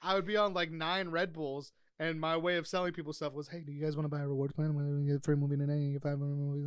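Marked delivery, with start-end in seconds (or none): 3.5-6.6 rattled off fast with no breaks; fast sales pitch. Retold or imitated. Hyped video store clerk pushing a rewards plan, fast. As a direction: rush it like you're on nine Red Bulls. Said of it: "talk as fast as possible".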